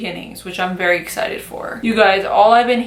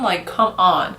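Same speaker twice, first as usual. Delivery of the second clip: ordinary talk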